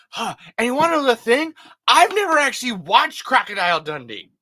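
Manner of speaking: usual speaking voice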